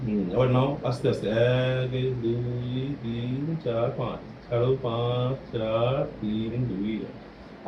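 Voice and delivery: slow count fading to almost nothing; slow breathing count. Shown as a demonstration. Therapist pacing a child's breathing, slow and fading. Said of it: "আমি যেভাবে বলছি সেভাবে করবে".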